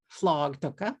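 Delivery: "flog" with an L in place of the R; mispronounced frog. Shown as a demonstration. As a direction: say "flog", swapping the R for an L as a learner would. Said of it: "nanka aru ga hen ni natteru".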